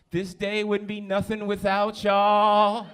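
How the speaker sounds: high, loud, slow sappy declaration; mock heartfelt. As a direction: ham up the sentiment. Mocking a deep birthday post.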